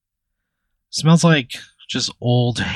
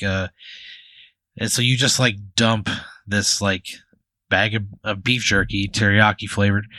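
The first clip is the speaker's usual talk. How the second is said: usual speaking voice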